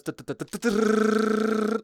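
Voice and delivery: frantic fast fire mimic; sped-up firing duhs. Shown as a demonstration. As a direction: speed up the mouth gunfire. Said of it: "Kill someone, it goes".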